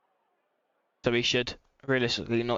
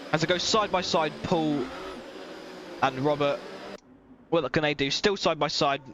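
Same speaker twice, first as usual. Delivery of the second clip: motorsport commentator; 3 semitones higher than usual. Acted. Live race play-by-play commentary.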